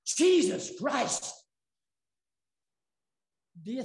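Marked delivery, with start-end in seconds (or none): 0.0-1.3 mild mock yell, stretched out slowly; half-hearted imitation of a startled oath; retold shout. Preacher retells Bradley's oath on the jet ski, mild mock yell. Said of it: "he shouts out in a loud voice".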